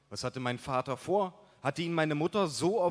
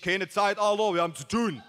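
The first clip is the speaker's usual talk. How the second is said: loud, higher brush-off; calling out; 6 semitones higher, 5 dB louder than usual. A narrator's reading. Narrator turning his buddy away, loud raised voice. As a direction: call it across to send him off. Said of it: "rief ich".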